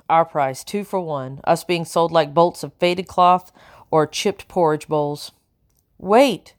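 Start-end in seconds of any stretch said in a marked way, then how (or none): 6.0-6.5 called out higher to stop the sale; loud announcement. A narrator's reading. Cook interrupting the sale of the girls, loud. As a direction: cut into the bargaining with a sudden call. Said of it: "Jenny announced loudly".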